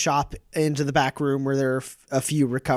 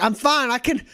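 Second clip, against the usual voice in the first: insisting fine; 11 semitones higher than usual. Acted. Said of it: "And she's like".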